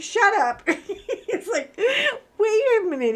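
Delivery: high-pitched retort breaking into laughter; laughing retort. Retold or imitated. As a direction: retell it loudly through giggles. Retold teasing exchange with husband.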